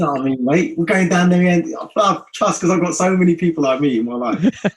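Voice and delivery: loud, lively London geezer talk; South London accent. Acted. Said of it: "It's kind of a London South".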